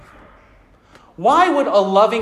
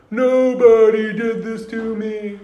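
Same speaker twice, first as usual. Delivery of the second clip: confused Cyclops; 4 semitones higher, slower than usual. Retold or imitated. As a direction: howl the name like a dazed monster. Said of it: "And the Cyclops goes".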